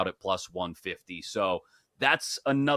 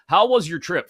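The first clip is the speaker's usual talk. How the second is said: ordinary talk, nothing marked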